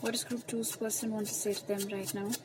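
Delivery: slow, soft, hushed asking of the cards; ritual question to spirit. Acted. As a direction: ask the spirit slowly and softly while shuffling.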